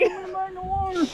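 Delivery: high squeaky voice for the fish. Pretend. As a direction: speak as the caught fish, high. Fish speaking voice, playful.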